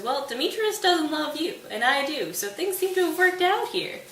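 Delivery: high, pushy mock reasoning; pleased-with-himself argument. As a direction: argue it brightly, voice climbing high. Lysander smugly argues his case.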